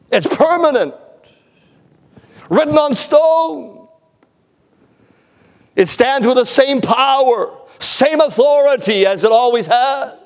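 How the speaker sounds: a preacher rising to his climax; fervent preaching. Acted. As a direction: declare it from the pulpit. Preaching at full force.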